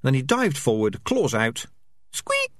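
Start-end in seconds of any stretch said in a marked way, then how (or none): 2.1-2.5 tiny high squeak of a mouse. Acted. Mouse squeaking in the cat's claws.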